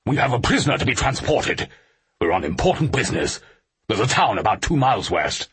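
high-pitched, businesslike soldier voice; brusque soldier. Acted. Soldier refuses the stranded officer a lift, brusquely.

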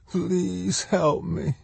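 high, soft, slow plea; begging. A narrator's reading. Trapped woman begging a boy for help, pleading. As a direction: plead weakly in a high, stretched voice. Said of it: "she begged".

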